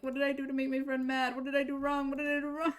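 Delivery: high, upset repeating of the question; almost crying. Retold or imitated. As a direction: ask again and again, close to tears. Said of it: "almost crying because of it".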